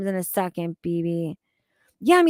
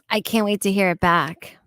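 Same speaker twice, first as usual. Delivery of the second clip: ordinary delivery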